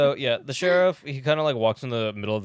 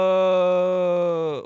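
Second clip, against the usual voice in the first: long, loud, drawn-out mock cry; horrified sheriff; 5 semitones higher, 5 dB louder than usual. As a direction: wail it out and hold the vowel. Sheriff cries out in horror.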